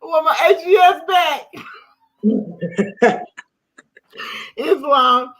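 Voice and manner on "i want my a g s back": high, loud yearning outburst; longing cry. Real, not acted. Missing her teacher AGS, a loud high yearning cry. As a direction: cry out missing him, laughing. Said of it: "I miss him so much".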